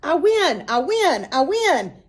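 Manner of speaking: loud, high victory yell; yelling in triumph. A narrator's reading. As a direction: shout it to the whole yard.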